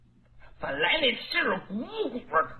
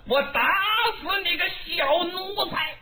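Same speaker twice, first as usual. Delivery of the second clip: raging warlord's threat, pitched up on the last words; angry threat; 9 semitones higher than usual. Acted. Dong Zhuo threatens Lü Bu, furious and pitched high. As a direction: bellow it at the fleeing servant.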